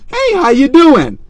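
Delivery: high, slow and sweet; timid nice girl. Retold or imitated. Mocking the type who greets dangerous men, high and slow. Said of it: "the type that'd be like".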